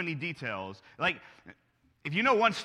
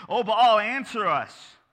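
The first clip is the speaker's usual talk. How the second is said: calling out; 7 semitones higher, 10 dB louder, much slower than usual. Acted. Prophets cry out to Baal.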